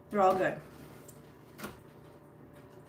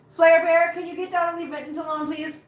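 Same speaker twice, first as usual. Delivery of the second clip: loud higher call to the cat; 8 semitones higher, 5 dB louder than usual. Real, not acted. To Flare Bear on the table, a loud calling command.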